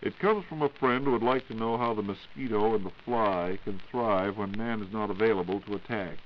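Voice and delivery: ordinary delivery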